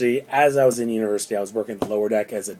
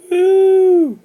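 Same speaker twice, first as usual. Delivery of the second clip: a high, loud, hollow "Woo!"; sarcastic cheer; 11 dB louder than usual. Retold or imitated. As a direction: cheer with mock enthusiasm at your own job. Sarcastic self-mocking cheer.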